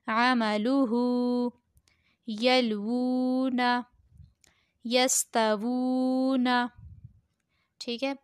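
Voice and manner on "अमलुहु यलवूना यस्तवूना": slow recitation with long-held vowels; Qaida word recitation. Shown as a demonstration. Teacher reading Qaida words to pupils, slow recitation. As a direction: draw each word out evenly.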